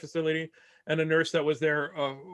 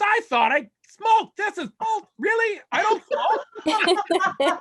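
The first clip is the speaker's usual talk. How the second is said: imitating a bewildered dad, very high and loud; slow, high-pitched mock disbelief; incredulous; 6 dB louder, much slower than usual.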